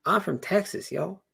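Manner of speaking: high Texan twang with rising y'all; Southern accent. Acted. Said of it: "trying on this Southern accent".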